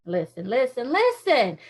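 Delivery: the word listen said three times in a put-on voice; little boy's mock voice. Speaker mimicking a little boy calling listen. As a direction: call listen like a small child.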